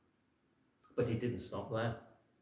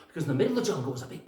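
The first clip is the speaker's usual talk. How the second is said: ordinary delivery, nothing marked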